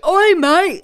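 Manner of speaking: high, loud caricature British shout; mock British accent. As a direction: imitate a crude British call. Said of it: "It used to be".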